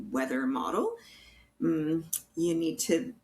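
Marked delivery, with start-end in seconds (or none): none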